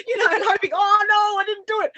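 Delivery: very high, loud pleading sorry to the universe; frantic apology. Retold or imitated. Apologizing to the universe for negative talk, high and frantic. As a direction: squeal out hurried apologies. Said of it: "constantly saying".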